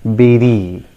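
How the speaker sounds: "berry" as BEH-ree, falling; correct pronunciation. Shown as a demonstration. Teacher giving learners the correct form, "berry" as BEH-ree. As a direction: say "berry" with an eh vowel. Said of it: "in the same way it is pronounced".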